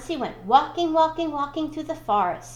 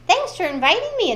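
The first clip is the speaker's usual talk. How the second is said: Elephant voice; 8 semitones higher, 7 dB louder than usual. Acted.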